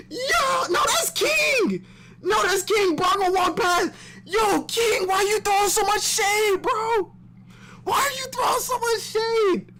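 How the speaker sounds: very high shouting; excited yelling. Real, not acted. Fan yelling at King in the comic. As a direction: yell at the page.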